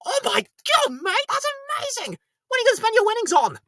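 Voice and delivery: mate reacting to the win; amazed friend. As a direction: gush with disbelief. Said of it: "The traditional Scottish move".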